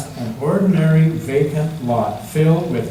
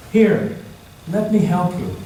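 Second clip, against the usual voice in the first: kindly helper voice; 5 semitones higher than usual. Acted. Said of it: "then said to five different people".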